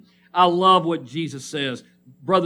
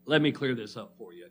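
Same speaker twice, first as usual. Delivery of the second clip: usual speaking voice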